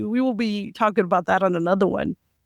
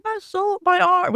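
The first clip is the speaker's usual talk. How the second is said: mock crying; 9 semitones higher, slower than usual. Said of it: "the way he's crying".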